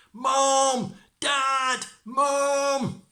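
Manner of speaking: loud high yell; shouting for parents. Acted. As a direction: call out at the top of your lungs. Tom calling his parents.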